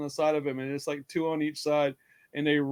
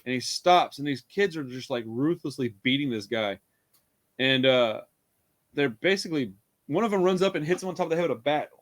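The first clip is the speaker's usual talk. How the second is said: normal talking voice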